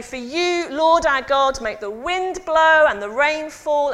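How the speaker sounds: high, loud prayer to God; loud priestly prayer. Acted. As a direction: pray it out over the crowd. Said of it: "then the priest would say".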